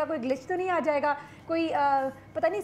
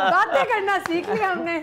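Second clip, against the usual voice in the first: laughing; 3 semitones higher, 4 dB louder than usual. Real, not acted. Host teases chef about learning talk, laughing. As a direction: laugh while teasing the chef.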